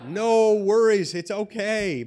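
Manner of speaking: loud, high, drawn-out call; calling after someone. Retold or imitated. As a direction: shout reassurance after someone running off, stretching the words. Calling after the fleeing guard.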